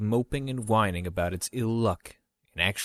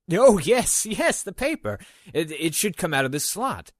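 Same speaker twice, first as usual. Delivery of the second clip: startled; 9 semitones higher, 5 dB louder than usual. Acted. Kimu distracted, startled back.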